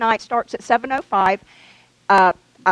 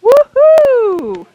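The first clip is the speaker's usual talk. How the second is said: excited cheer; 13 semitones higher, 10 dB louder than usual. Real, not acted. Cheers at the news of children's church.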